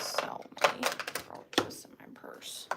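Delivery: hushed whispered echo of the brooch confession; whispered repeat. Real, not acted. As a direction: whisper it like a guilty secret.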